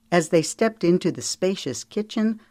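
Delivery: ordinary delivery, nothing marked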